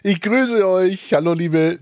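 high, slow grand welcome; drawn-out showman greeting. Acted. Host greets guests theatrically. (feierliche Begrüßung)

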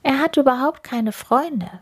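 lightly voiced hare, pitched high; hare thinking. A narrator's reading. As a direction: lift the voice for a small hare. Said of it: "dachte der Hase".

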